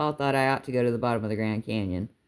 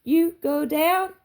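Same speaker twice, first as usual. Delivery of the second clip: high, slow, drawn-out teasing question; singsong child's question, voice way up; child calling out; 4 dB louder, much slower than usual. A narrator's reading. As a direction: call it out in a high, piping little voice.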